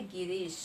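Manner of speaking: गिरीश in one go with a long "ee"; teacher demonstrating unstressed गिरीश; unforced long vowel. Shown as a demonstration. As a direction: let गिरीश run out smoothly, no extra push. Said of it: "जिसका उच्चारण एकदम से हो रहा है".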